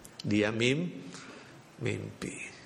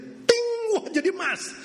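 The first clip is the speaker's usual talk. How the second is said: high chime then shocked cry; startled ting; 4 dB louder than usual. Acted. Water turning gold at her lips, high startled cry.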